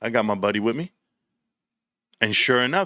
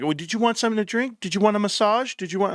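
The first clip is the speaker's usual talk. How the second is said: high, sweet overeager server offer; mock a fawning casino waitress; 8 semitones higher than usual. Mocking the Indian casino staff offering drinks, high sugary voice. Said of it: "They'll be like".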